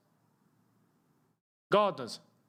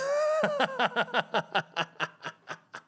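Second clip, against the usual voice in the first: high-pitched cackling laugh. Real, not acted. Laughing at own joke.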